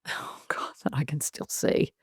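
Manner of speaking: whispered. Real, not acted. Haunting memory confided.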